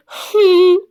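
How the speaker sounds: mock tearful whine; whimpering. Yura whimpers to her manager that someone came.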